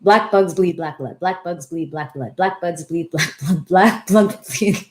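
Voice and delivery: fast tongue twister. Shown as a demonstration. Host attempting the tongue twister fast for viewers, rapid and stumbling. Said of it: "if I can do two times fast".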